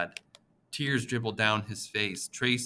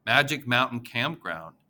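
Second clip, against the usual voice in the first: usual speaking voice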